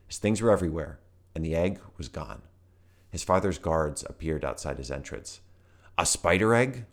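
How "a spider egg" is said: high, loud sneer of disbelief; an older brother mocking Volt. A narrator's reading. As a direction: scoff at the words spider egg. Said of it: "one of Volt's older brothers sneered".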